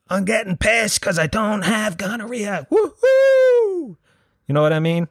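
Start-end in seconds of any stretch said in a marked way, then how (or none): 0.1-3.9 higher, slow mock party cheer building to a shrieking woohoo; mock celebration. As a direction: ham up a giddy celebrant who ends in a squeal. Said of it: "go around celebrating, going".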